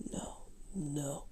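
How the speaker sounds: breathy whispered echo. Acted. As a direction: whisper it like a backing vocal. Whispered backing line in song.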